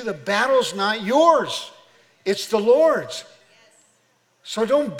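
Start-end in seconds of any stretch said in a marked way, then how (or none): 0.0-3.2 slow, raised, emphatic proclamation; full-force preaching. Acted. Preacher tells what Jesus says to you, full-force preaching. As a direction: proclaim it slowly and loudly, weighting each phrase.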